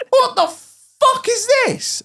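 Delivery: very high, drawn-out outraged question; hammed-up disbelief. Finding the gates screwed shut, high exasperated disbelief. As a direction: stretch it out in mock horror.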